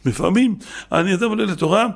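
usual speaking voice

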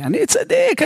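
very high, mock-indignant squeal of innocence; self-righteous protest. Congregant sure the rabbi means someone else, self-righteous protest. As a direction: jump way up in pitch, affronted. Listener indignantly exempts himself.

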